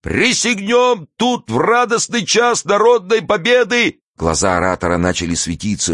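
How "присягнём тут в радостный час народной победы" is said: high-pitched, slow oratory with drawn-out words; stretched, ringing public speech; impassioned street orator. A narrator's reading. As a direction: harangue the crowd at a high pitch.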